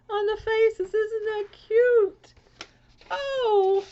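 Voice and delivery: high, loud coo, slow on "Isn't that cute?"; high, slow, delighted coo. Real, not acted. Cooing over card pictures.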